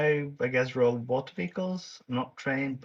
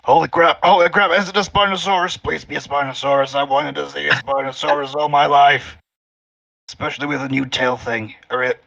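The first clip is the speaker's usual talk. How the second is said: real excitement; 3 semitones higher, 9 dB louder than usual. Real, not acted. Player hoping the creature is a Spinosaurus, loud excited outburst. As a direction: burst out like a thrilled fan, loud and pleading. Player thrilled a dinosaur may appear.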